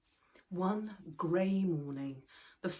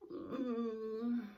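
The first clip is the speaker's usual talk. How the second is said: sleepy mumble; 5 semitones higher than usual. Acted. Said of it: "mumbled Moomin Troll sleepily".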